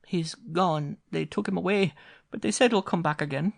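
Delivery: put-on high child voice, frightened; small boy telling what happened; boy answering his mother; little boy. A narrator's reading. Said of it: "answered little Con".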